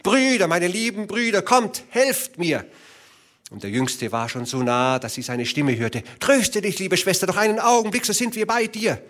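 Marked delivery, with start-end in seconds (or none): 0.0-2.6 final desperate cry to her brothers. A narrator's reading. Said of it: "in der höchsten Not und aus allen Kräften". 6.2-9.0 high, fast calling voice; urgent reassuring shout from afar; youngest brother. A narrator's reading. Youngest brother calls to sister.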